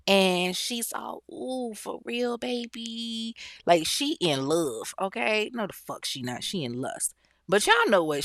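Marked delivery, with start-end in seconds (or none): 1.3-3.4 slow, soft, high and cooing; mock swooning. Smitten woman answering his sweet talk, mock swooning. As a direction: coo it softly, drawn out and higher.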